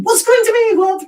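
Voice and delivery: high, loud comic outburst; mock shouting. Shown as a demonstration. Showing how the saying sounds, mock shouting. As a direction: yelp it out in mock protest. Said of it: "It's like, we're shouting".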